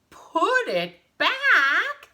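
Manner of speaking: very high, loud, drawn-out question; hammed-up group shock; astonished question. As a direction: ask in stunned disbelief. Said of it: "they asked her".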